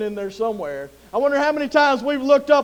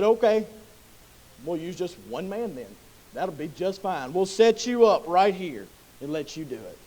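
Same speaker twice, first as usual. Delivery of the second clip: much softer and lower, offhand and calm; easygoing Lord; 7 semitones lower, 8 dB quieter than usual. Acted. The Lord calmly agreeing to one man. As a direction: toss it off softly, unbothered. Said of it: "The Lord said".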